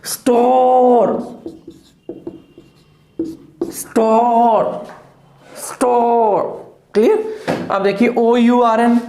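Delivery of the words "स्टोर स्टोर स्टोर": स्टोर with the R left unstressed, long drawn-out vowel; silent R. Shown as a demonstration. Teacher models स्टोर for students, slow with a silent R. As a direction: stretch स्टोर slowly and let the final R melt away. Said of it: "बिल्कुल unstressed कर दो आर को".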